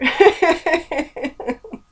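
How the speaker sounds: high nervous burst of laughter. Real, not acted. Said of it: "I laugh, it's a tick".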